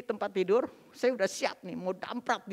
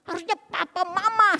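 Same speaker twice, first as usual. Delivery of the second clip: high, loud re-enacted tirade at a father-in-law; angry accusing outburst; furious confrontation; 10 semitones higher, 7 dB louder than usual. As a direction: blast the in-laws with blame, hands on hips. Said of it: "saya udah marah luar biasa".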